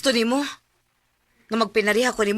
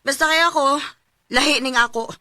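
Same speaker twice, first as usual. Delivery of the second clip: angry dismissal; 3 semitones higher, 8 dB louder than usual. Acted. Chris angrily dismissing Dodoy, louder. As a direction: shut him down in anger. Couple quarrel about drinking.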